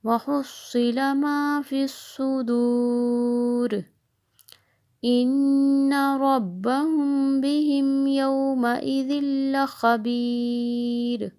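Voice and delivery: slow, high, loud melodic tilawat; Quran recitation. Acted. Teacher reciting the full surah for students to practise. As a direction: chant the whole surah with long held notes.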